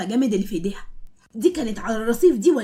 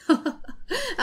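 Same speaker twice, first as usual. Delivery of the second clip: sly laugh; 9 semitones higher, 4 dB quieter than usual. Acted. Zein's scheming laugh.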